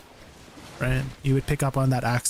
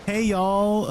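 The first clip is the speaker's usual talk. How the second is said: higher-pitched mock Southern greeting; American Southern accent; 5 semitones higher than usual. Streamer trying Southern again for chat, higher mock Southern accent. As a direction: call it out in a playful Southern twang. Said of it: "American Southern accent".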